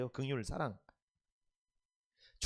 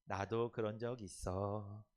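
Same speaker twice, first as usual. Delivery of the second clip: slow gentle greeting; 6 semitones lower, 5 dB quieter, much slower than usual. Shown as a demonstration. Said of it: "시작".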